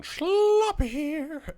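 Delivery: high, slow, drawn-out intro call; signature intro call. Acted. Host greeting viewers with a high, drawn-out signature call. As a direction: belt out the channel greeting like a mascot.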